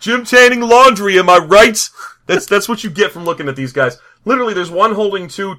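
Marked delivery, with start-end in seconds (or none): 0.0-1.9 loud, higher, drawn-out bro voice; Jersey gym rat. Acted. Riffing on dwarf bros' picture, loud gym-rat brag. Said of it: "basically like Jersey gym rats".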